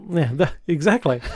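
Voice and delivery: normal talking voice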